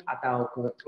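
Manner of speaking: ordinary delivery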